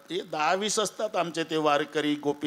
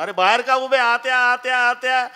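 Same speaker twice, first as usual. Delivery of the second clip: calling out; 9 semitones higher, 4 dB louder than usual. Retold or imitated. Host calls family to serve.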